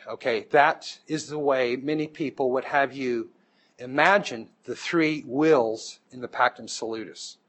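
ordinary delivery

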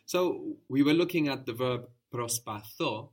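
ordinary delivery

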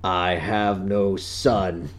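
mock grave father; grim disowning. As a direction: intone it deadpan. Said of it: "it'll be like".

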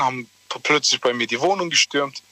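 ordinary delivery

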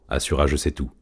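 ordinary delivery

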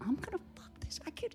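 hushed, breathy whisper to himself; unvoiced whisper of inner panic.

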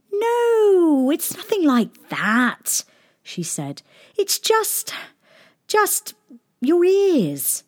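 high, hesitant princess; falter, high and awkward; cartoon princess. A narrator's reading.

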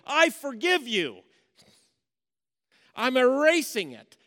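loud, high, proclaiming divine pardon; voice of God forgiving. Acted. God speaking to all humanity, loudly forgiving. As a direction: declare it like God looking you in the eye. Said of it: "and saying".

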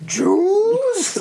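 "Juice?" squeaked very high, falling; high squeaky "Juice?". Retold or imitated. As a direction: squeal "Juice?" as a running gag. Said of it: "everyone was like".

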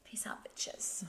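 slow whispered sign-off. Pretend. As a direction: whisper the goodbye slowly.

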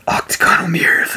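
high, whispery eerie mumbo-jumbo; creepy sleep talk. Retold or imitated. Faking sleep talk to freak out Avery, creepy whisper. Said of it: "saying all this creepy shit".

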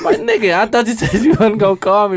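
high-pitched mock protest; retold indignant relief. Man retells scolding the doctor who called, high mock protest. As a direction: replay the outraged reply, pitch leaping.

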